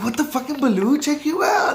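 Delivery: high, carried-away hype; excited homie. Retold or imitated. Two friends on their first walk, excited and high-pitched. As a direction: imitate hyping up an old friend. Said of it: "we were excited".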